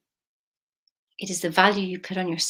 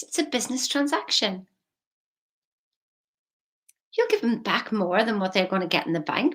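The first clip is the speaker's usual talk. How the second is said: usual speaking voice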